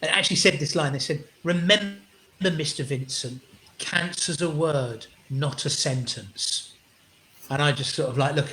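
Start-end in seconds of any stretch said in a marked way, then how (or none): 1.4-6.7 slow, weighty delivery with pauses; imitating a carer's measured wisdom; solemn pronouncement. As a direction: deliver it like a profound line, word by word. Quoting carer's solemn line.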